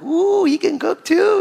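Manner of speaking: high, drawn-out mock-girlish swoon; admiring girls. Imagined college girls admiring his cooking, mock-girlish high coo. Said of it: "all the girls being like".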